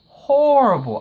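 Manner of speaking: "horrible" thrown high and loud; emphatic repeat. Real, not acted. Reviewer stressing how cruel she was to Mob, emphatic "horrible". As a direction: hit "horrible" hard, pitch leaping up then falling.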